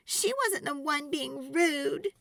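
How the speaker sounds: put-on hurt little girl. A narrator's reading. Girl protesting to mom, tearful. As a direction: near tears, defend her friend. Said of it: "Sophie asked".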